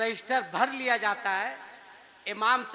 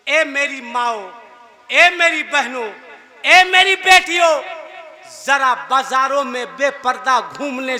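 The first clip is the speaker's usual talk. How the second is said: building sermon appeal; 14 dB louder than usual. Real, not acted. Preacher appeals to mothers and daughters, building to shouting. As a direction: rise to an impassioned plea.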